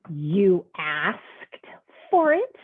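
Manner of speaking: incredulous jump up on the last words; surprised. Acted. Pigeon reacts to duck.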